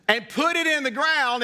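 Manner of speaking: ordinary delivery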